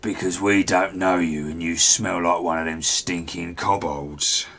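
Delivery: a touch of goblin contempt; scornful goblin guard. Acted.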